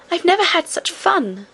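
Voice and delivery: put-on high, light girlish princess voice; cartoon princess. A narrator's reading. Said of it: "said the princess".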